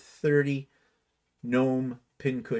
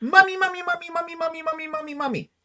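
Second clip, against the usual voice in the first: put-on much higher, whining child calling mummy; whiny little child; 4 dB louder, faster than usual. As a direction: whine like a small kid tugging a skirt. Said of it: "like a five-year-old tugging at his mother's skirt".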